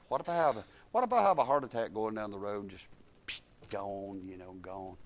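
low, soft fretful inner voice; musing quietly on sudden death; quiet imagined worry. Retold or imitated. Preacher voicing his own old fear, low and soft. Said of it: "I thought".

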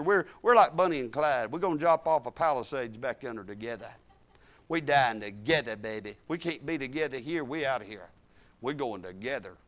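low, soft and playful; quiet tender aside. Retold or imitated. Preacher quoting himself to his wife, low and soft. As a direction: murmur it like a private joke to your wife. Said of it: "retelling talk to wife".

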